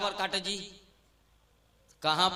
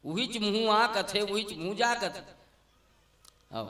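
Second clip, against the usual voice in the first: normal talking voice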